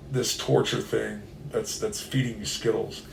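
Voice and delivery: ordinary talk, nothing marked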